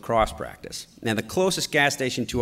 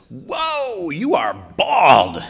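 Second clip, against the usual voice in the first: put-on higher whoa, then slow and louder, like a kid stating the obvious; mock little boy blurt; 6 semitones higher, 8 dB louder, much slower than usual. Son to the bald gas attendant, slow innocent kid blurt. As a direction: spell it out slowly, wide-eyed and innocent.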